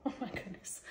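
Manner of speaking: breathy whispered laugh over the cat; whispered giggle. Real, not acted. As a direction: giggle it in a hush, melting. Cooing over her cat.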